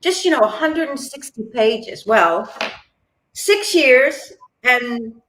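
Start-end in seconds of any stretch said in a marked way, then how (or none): 0.0-2.0 high, airy, casual push; quoting the agent, pitched high and light; breezy agent. Retold or imitated. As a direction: make it sound easy. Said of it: "So she".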